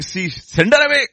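acted exasperated disciples; irritated. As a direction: urge it loudly, fed up with her. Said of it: "Disciples got irritated".

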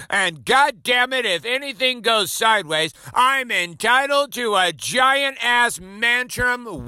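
mock entitled whiner. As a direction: ham up a spoiled adult demanding his rights. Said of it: "'cause you're like".

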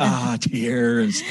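hammed-up weepy voice; mock crying. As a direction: pretend to be in tears. Pretend crying in the retold scene.

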